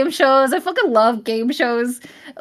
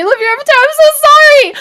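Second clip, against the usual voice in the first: much higher, loud burst of apology; excited shout; 7 dB louder, much faster than usual. Real, not acted. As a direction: blurt it out high and loud. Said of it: "I'm shouting, I'm yelling so much".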